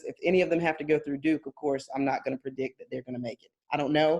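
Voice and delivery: ordinary talk, nothing marked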